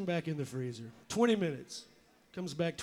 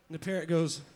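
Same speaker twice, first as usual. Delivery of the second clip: ordinary talk, nothing marked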